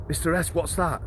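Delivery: a hint of a nervous prisoner asking; worried prisoner. Prisoner asks the officer about the catheter, lightly alarmed.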